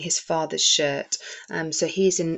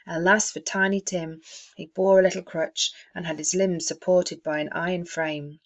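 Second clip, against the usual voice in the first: ordinary talk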